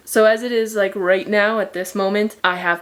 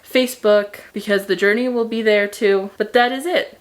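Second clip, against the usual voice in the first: normal talking voice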